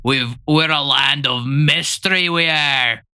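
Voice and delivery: put-on Scottish accent. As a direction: do a mock Scottish lilt. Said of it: "That's how you sound to the rest of the world".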